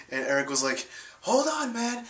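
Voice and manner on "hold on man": much higher mock call to wait; a squeaky-high take on a friend saying hold on; imitating friend. Friend telling the driver to stop, imitated much higher. Eric voiced stopping the car.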